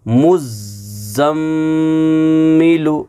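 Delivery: slow मुज़्ज़म्मिलु with a long hummed m; nasal ghunna. Shown as a demonstration. Teacher reads it for pupils, मुज़्ज़म्मिलु with long nasal ghunna. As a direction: hold the m of मुज़्ज़म्मिलु in the nose. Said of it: "आवाज़ को छुपाएंगे".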